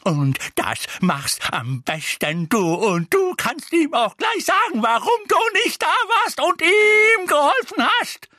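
enraged, climbing tirade; screaming. A narrator's reading. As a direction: escalate to full-pitched fury. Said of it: "schrie Misses Figg".

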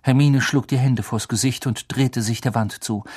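ordinary delivery

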